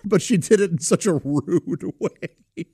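slow, drawn-out, breaking into laughter; laughing words. Real, not acted. Uncle recalling niece's rude kindness, laughing through words. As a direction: let the laugh take over the sentence.

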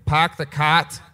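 put-on slow drawled Costin accent. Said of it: "That's a Costin accent".

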